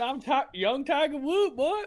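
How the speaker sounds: high, slow mock Southern drawl; bad Southern accent. Host joking along with the golf story, bad Southern accent. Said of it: "I'll stop my shitty Southern accent".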